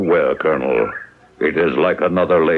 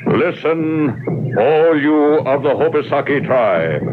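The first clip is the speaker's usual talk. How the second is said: higher, ceremonial oration voice; formal proclamation; 6 semitones higher than usual. Acted. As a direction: announce it to the whole tribe. Chief formally proclaims the treaty.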